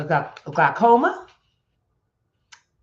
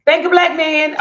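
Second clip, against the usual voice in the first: catchphrase shout; 11 semitones higher, 9 dB louder than usual. Pretend. Signature tagline shouted again.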